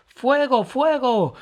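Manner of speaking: very high, loud alarm cry; shouting fire. Acted. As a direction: scream the alarm about fire. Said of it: "gritó Emma".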